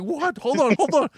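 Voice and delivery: high, jolted and hurried; startled excitement. Retold or imitated. Driver startled by his buddy's yell, high and jolted. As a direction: jump up high, caught off guard. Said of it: "And I'm like".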